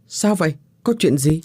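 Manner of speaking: suddenly alarmed by the news; surprised question. Acted. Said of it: "ngạc nhiên hỏi".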